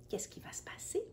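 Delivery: suspenseful whisper. Real, not acted. Reader whispers a teaser to the child. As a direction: hush the question to build suspense.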